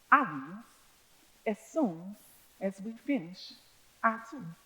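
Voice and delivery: controlled polite restraint; measured tone. Retold or imitated. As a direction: stay calm and quiet under pressure. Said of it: "in as a measure of a tone as I can muster".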